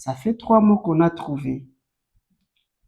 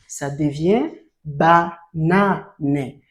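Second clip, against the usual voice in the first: slow sounding out; much slower than usual. Shown as a demonstration. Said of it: "teacher shows blending syllables".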